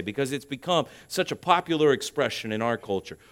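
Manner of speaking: usual speaking voice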